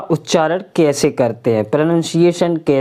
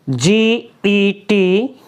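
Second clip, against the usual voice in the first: slow spelling; much slower than usual. Shown as a demonstration. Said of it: "spelling drill".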